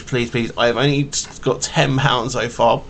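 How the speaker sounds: ordinary delivery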